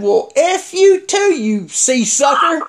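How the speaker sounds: high, loud, drawn-out mock yell; loud retold outburst. Man retelling his drive-thru comeback, loud angry outburst. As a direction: hurl the insult slowly and loudly, acting it out. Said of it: "loud as I could".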